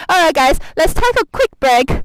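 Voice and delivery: breathless, laughing between words; gasping and giggling while walking; out of breath. Real, not acted. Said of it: "let me catch my breath".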